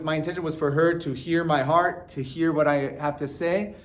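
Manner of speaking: normal talking voice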